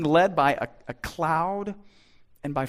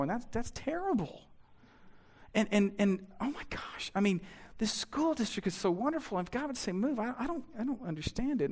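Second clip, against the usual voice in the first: soft, hushed mock worry; mock anxious fretting; 5 semitones higher, 8 dB quieter than usual. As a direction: fret under your breath. Anxious believer inner voice.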